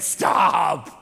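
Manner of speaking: loud, disbelieving cry; loud mock stop. Speaker to the Target clerk, a loud mock cry of disbelief. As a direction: cut him off in mock disbelief.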